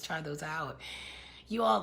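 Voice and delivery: ordinary talk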